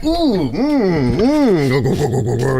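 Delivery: playful eager chugging noises; mock delight. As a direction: savour it like a treat. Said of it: "you're like".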